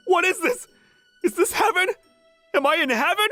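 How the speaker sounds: high, giddy amazement; overjoyed disbelief. Acted. Fan seeing idol in the kitchen, dazzled. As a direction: marvel as if you've landed in paradise.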